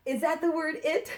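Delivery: teacher teasing her pupils with a trick; playful trick question. Pretend. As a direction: bait them with a mock-serious question. Teacher tricks child on purpose.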